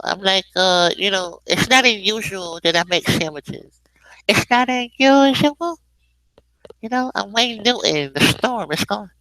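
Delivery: high put-on chatty voice; sandwich-chef guest character. Guest chef telling host about sandwiches, high character voice.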